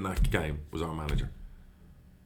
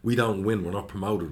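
ordinary delivery

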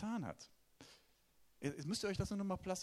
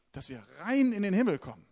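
louder, hammer the word rein in a mocking tone; loud mocking outburst; 3 semitones higher, 12 dB louder than usual. Preacher mocks fearful churchgoers, loud mocking outburst. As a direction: exaggerate the fear, loud, with rein stressed.

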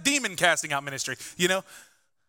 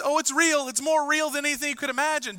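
high-pitched, insistent retort; preacher voicing an adamant correction; emphatic insistence; 9 semitones higher than usual. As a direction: insist on it as an acted comeback. Acted emphatic retort in Jesus' voice.

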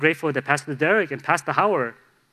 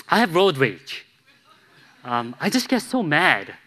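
ordinary delivery

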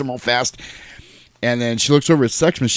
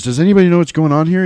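quick, higher, louder quoted question; somebody in the group; 7 semitones higher, 5 dB louder, faster than usual. Retold or imitated. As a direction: blurt it out as an urgent film line. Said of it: "Somebody goes".

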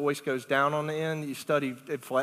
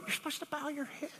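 high, soft imitation of children; scolding kids; 12 semitones higher, 11 dB quieter than usual. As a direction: imitate kids correcting a friend in a small high voice. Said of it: "all the kids are like".

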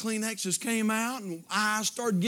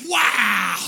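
awestruck wow; 9 dB louder than usual. Acted. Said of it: "you're gonna say".

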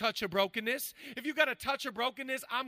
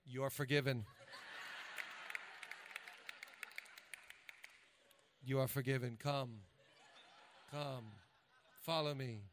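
mock holy pronouncement; 8 semitones lower, 10 dB quieter than usual. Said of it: "I wanted to be like".

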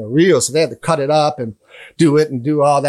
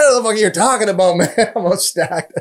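laughing denial; 5 semitones higher than usual. Retold or imitated. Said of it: "I said".

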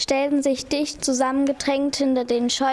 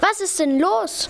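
higher, worried question; concerned; 7 semitones higher than usual. A narrator's reading. Papsi asks Pupsi what happened, concerned. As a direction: ask it with concern. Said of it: "fragte Papsi besorgt".

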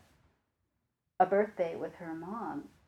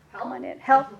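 louder urgent call to come in and help; 6 semitones higher, 7 dB louder than usual. Retold or imitated. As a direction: call "help" out to the colleague next door. Calling colleague for help.

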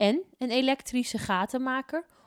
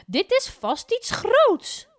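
very high, loud, slow; excited; 9 dB louder, slower than usual. A narrator's reading. Mevrouw Poepies sure of a big find, high slow excitement.

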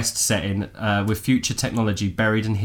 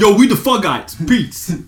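high, loud hip-hop swagger; rapper voice; 9 semitones higher, 7 dB louder than usual. Pretend. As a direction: throw it out like a rapper.

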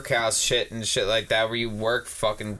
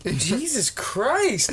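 high, slow drawn-out mock disbelief; the man shakes his hand, high and slow in mock disbelief; 11 semitones higher, much slower than usual. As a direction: stretch it out in stunned mock disbelief. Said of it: "was like".

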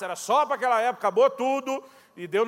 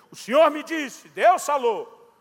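alta e aguda, com ênfase; proclamação profética; 5 semitones higher, 8 dB louder, much slower than usual. Retold or imitated. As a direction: proclama como profeta, em voz forte. Imita o camarada profetizando. (proclamação profética em voz alta)

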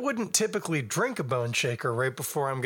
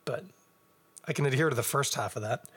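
ordinary delivery

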